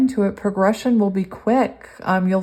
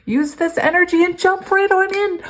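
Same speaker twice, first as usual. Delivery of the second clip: much higher, louder, thrilled reading; 12 semitones higher, 5 dB louder than usual. Real, not acted. Reader thrilled as the card echoes her, excited high read. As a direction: burst into the line with joy. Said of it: "I'm so excited".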